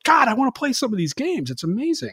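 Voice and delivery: high-pitched enthusiastic quote; gushing. Retold or imitated. Fan after watching the series documentary, gushing. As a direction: gush like a fan. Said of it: "you're just like".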